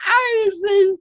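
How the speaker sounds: very high, loud Scottish wail; hammed-up Scottish sobbing; crying Scottish woman. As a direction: mimic a Scottish woman wailing in grief. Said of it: "a crying fucking Scottish woman".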